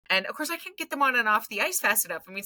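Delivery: ordinary talk, nothing marked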